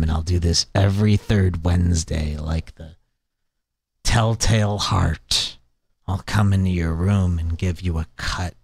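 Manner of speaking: whisper-like creepy villain menace; creepy menacing voice. Pretend. Creepy imagined threat voice.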